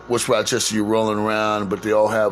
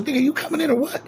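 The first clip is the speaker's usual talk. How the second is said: higher, casual impatient friend voice; friend calling him in; faster than usual. Retold or imitated. Richard waving his friend inside, high, casual and impatient. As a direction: toss it off like a buddy at the door. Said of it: "He goes".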